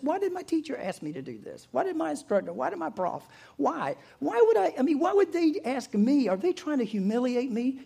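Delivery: high-pitched, mock-distraught questioning; acted inner panic, voice lifting high; exasperated self-doubt. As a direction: fire off bewildered whys, pitch climbing.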